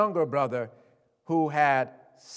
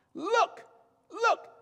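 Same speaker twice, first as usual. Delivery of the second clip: high, slow bitter complaint; aggrieved son; 9 semitones higher, 5 dB louder, much slower than usual. Retold or imitated. Older son complaining to father, high slow bitterness. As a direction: throw years of service at him.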